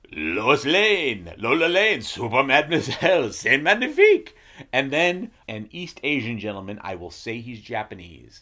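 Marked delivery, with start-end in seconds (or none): 0.1-4.3 put-on hammy French accent; French accent, rising to gushing. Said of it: "a French guy saying".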